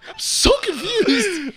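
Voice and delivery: high, slow, dopey voice; hammed-up bewildered guard; mock confused guard. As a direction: play it baffled and slow. Imitating confused security guard.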